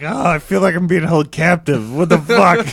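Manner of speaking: hammed-up despair; mock held captive.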